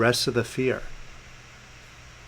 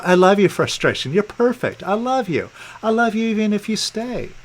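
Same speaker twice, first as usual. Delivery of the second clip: higher-pitched, upbeat declaration; emphatic loving affirmation; 6 semitones higher than usual. Shown as a demonstration. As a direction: lift the pitch and affirm it wholeheartedly. Demonstrates loving the frustration.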